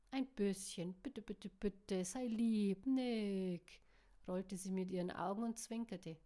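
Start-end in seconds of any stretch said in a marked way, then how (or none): 0.1-3.8 drawn-out, playful begging; pleading that builds towards the name; wheedling plea. A narrator's reading. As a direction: coax him sweetly, stretching the pleas. Wheedling him with repeated pleas.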